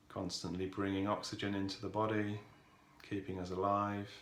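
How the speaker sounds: calm, softened voice with long pauses; guided meditation. Real, not acted. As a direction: ease listeners into stillness, unhurried and even.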